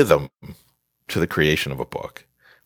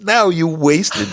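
high, drawn-out rebuke; exasperated scolding; 11 semitones higher, slower than usual. Acted. Author scolding writers who hire publicists too late, exasperated. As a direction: scold them with a high, stretched protest.

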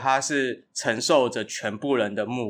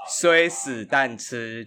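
slow spelled out; much slower than usual. Shown as a demonstration. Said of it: "所以就简称".

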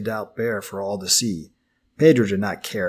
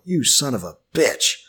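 furious outburst, higher and pushed; angry outburst; 3 semitones higher than usual. A narrator's reading. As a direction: spit the curse at him in rage. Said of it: "Joe's outburst".

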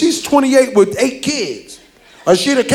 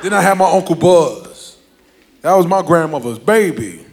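ordinary delivery

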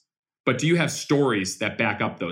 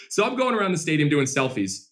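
ordinary talk

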